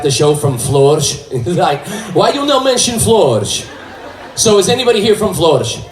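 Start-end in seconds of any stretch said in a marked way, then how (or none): 2.1-3.7 higher aggrieved immigrant voice; higher complaining accent; Portuguese accent complaint. Retold or imitated. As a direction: whine like an offended islander. Fan's Facebook complaint.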